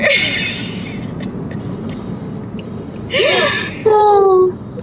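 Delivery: wheezing high laughter; high breathless laugh. Real, not acted. Telling the car story, breathless high laugh. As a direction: crack up at the memory.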